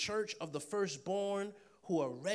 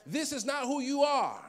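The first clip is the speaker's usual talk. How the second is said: higher, louder, full-voiced cry; preaching shout; 8 semitones higher, 8 dB louder than usual. Real, not acted. Preacher pressing the point home to congregation, loud shout. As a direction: shout it so the back row feels it. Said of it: "Come on, I want you to hear that".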